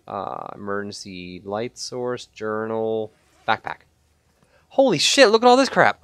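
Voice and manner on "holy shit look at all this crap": high, loud burst of excitement; amazed exclamation. Real, not acted. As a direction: blurt it out, thrilled by the haul. Amazed at the pile of loot.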